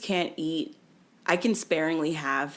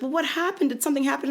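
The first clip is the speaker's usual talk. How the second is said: high coaxing nurse voice; 8 semitones higher, faster than usual. Retold or imitated. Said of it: "she was like".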